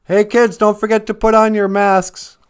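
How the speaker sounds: high, loud singsong mom; cheery parent voice. Pretend. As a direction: chirp it like a sitcom parent. Voicing cheery parent in skit.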